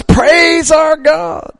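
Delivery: high, long-held rallying cry; soaring drawn-out call; choir director's shout. Acted. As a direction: shout it out like heaven's choir director, stretching each word. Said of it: "The director just comes out and says".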